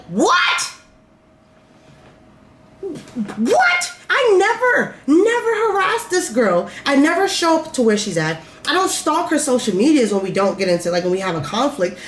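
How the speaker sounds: high-pitched indignant protest, easing toward the end; retold incredulous outburst; outraged disbelief. Retelling her outraged reply to police.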